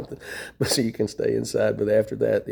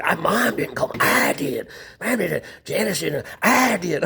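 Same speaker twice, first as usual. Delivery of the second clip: mocking brother; 8 semitones higher than usual. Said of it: "he was awfully fucking".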